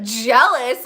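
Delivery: loud incredulous echo. Retold or imitated. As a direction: throw the word back at her. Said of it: "And I was like".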